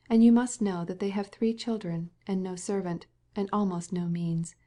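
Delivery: usual speaking voice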